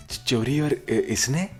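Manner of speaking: high, loud stammering disbelief; shocked stammer. Acted. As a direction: stutter out the shock.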